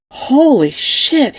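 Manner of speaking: slow, high, drawn-out amazement; long astonished exclamation leaping in pitch; awed exclamation. Acted. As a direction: stretch it out, blown away. First gulp of fizzy cola.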